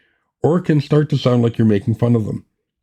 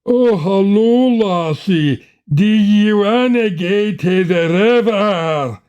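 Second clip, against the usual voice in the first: much higher, slow, hammed-up Scottish accent; mock Scottish caricature; 8 semitones higher, much slower than usual. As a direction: ham up an old-timey Scottish brogue, slowly. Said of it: "some sixteenth century caricature".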